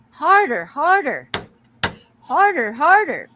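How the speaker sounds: high, loud moaning "harder" over and over; mock passionate moaning. Bird mimics owners from office.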